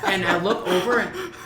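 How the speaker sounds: ordinary talk, nothing marked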